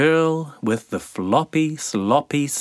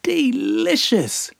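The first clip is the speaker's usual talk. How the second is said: squeaky sandfly cry. Acted. Namu feeding on the man, very high cry. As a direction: squeal it like a tiny glutton. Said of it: "cried Namu".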